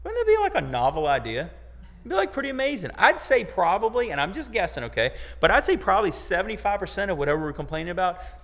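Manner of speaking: carried away by the idea, rising fast; excited. Real, not acted. Preacher gets worked up, animated.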